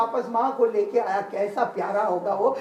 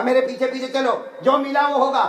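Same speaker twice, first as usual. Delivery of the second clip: loud, raised, grudging order; gruff anger; 5 semitones higher, 5 dB louder than usual. Retold or imitated. Butcher grudgingly letting the traveller follow him, angry. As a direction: order him along loudly and irritably. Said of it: "वो तो पूरा दिन गुस्से में".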